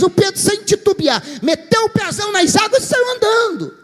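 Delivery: preacher shouts the moment Peter steps onto the water; excited preaching. A narrator's reading. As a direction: cry it out as the big moment. Preacher climaxing the story.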